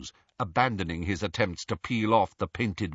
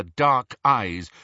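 usual speaking voice

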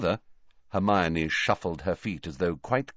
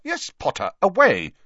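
clipped tart reply, away punched louder; 12 semitones higher, 6 dB louder than usual. A narrator's reading. McGonagall curtly correcting Harry, tart. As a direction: put him in his place tartly. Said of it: "said Professor McGonagall tartly".

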